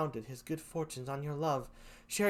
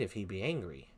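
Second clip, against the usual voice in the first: normal talking voice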